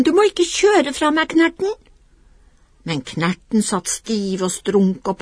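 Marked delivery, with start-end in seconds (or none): 0.0-1.7 pleading call. Acted. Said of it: "ropte han".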